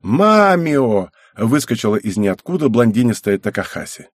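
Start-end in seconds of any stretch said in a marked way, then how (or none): 0.0-1.1 higher drawn-out call of a name; excited call. A narrator's reading. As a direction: call out to him as you burst in. Girl bursts in calling out his name.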